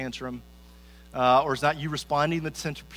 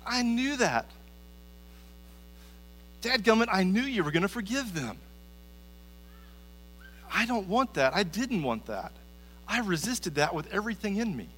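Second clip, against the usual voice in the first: higher-pitched, exasperated mock protest; angry Jonah; 5 semitones higher than usual. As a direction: act out an indignant complaint to God. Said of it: "He was angry".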